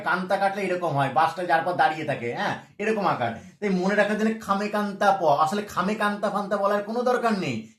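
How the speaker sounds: usual speaking voice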